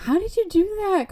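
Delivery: high-pitched mock little-kid astonishment; retold wide-eyed kid question; little sibling. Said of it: "The sibling's like".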